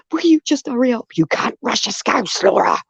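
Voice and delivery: high mock scold of the manager; quoted manager. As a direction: hurry me up in a lightly voiced boss tone. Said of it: "and I'd be like".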